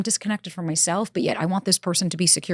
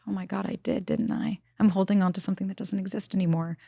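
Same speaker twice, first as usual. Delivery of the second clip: much softer, almost breathed under the breath; stunned realization; 6 dB quieter than usual. Retold or imitated. Realizing she's clinging to the past, hushed and stunned.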